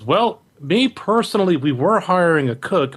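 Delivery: bartender voice. Acted. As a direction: speak slowly like a barkeep sharing a secret. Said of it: "he goes".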